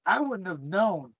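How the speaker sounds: ordinary delivery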